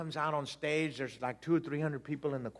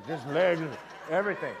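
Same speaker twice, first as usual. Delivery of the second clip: normal talking voice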